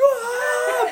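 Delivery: high screaming laugh; shrieking laugh. Real, not acted. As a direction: burst out in a panicked shriek of laughter. Panic at cop siren.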